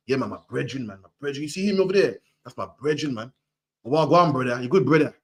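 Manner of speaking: lightly done Jamaican patois, low and easy; imitating a Jamaican granddad's relaxed talk; a hint of Jamaican elder greeting his brethren. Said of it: "Granddad couldn't pronounce the word brethren".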